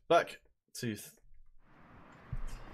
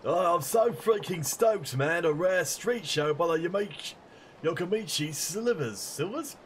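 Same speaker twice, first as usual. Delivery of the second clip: higher, louder, hyped-up music fan voice; stoked punk fan; 6 semitones higher, 6 dB louder than usual. Acted. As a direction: gush like a thrilled rock fan. Voices excited fan in game.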